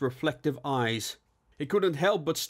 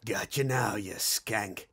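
low-key menacing hiss; acted villainous hiss; hissed threat; 3 semitones lower, slower than usual. A narrator's reading. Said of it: "aiming his large revolver and hissed".

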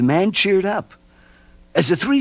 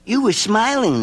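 put-on a touch higher boy voice; lightly voiced boy; 11 semitones higher than usual. A narrator's reading. Stevie explaining to the mope, lightly higher.

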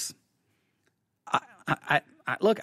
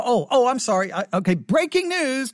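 normal talking voice

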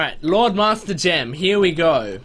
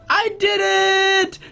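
much higher, slow victory shout; triumphant cry; much slower than usual. Real, not acted. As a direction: stretch it out in triumph. Finally beating the obby.

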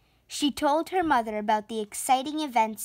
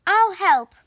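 high, loud little offer; piped up; 7 semitones higher, 7 dB louder than usual. A narrator's reading. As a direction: pipe up much higher and louder. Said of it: "Ella piped up".

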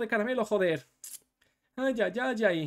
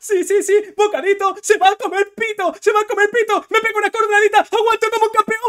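much higher, loud, triumphant; hyped shouting; 11 semitones higher, 12 dB louder than usual. Real, not acted. Streamer hyped during winning combo, very loud. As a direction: ride the hype up.

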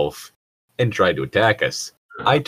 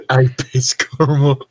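laughing; 6 semitones higher, 5 dB louder, slower than usual. Real, not acted. Laughing while mocking the line.

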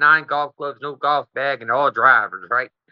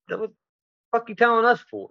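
incredulous guard; 8 semitones higher than usual. Retold or imitated. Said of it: "And they like".

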